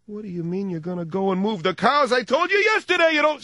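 starts level, then climbs high and loud; mock Italian blowup; Italian getting loud. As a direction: build from calm to yelling. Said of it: "they start out soft and they just, they end up loud".

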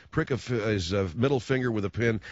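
usual speaking voice

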